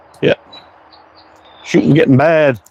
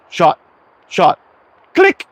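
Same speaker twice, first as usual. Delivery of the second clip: imitated shots and frame hit; 4 semitones higher, slower than usual. Son's shots retold as mouth sounds, high click. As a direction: mouth sounds, last one squeaked high. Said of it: "He goes".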